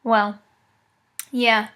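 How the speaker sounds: usual speaking voice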